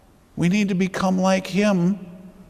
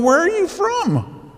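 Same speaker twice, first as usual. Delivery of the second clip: much higher, wide-eyed wonder on Where are you from; amazed asking; 13 semitones higher than usual. Retold or imitated. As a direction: gasp out the question as if astonished. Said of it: "They should be looking at you and going".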